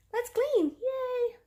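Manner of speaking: high, loud pretend cheer; mock excitement. Vlogger rallying herself to clean, mock excited. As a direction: put on a big burst of cleaning enthusiasm. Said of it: "not really as excited".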